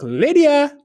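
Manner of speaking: very high, loud call for the cook; yelling again. A narrator's reading. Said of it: "the woman yelled for the chief cook".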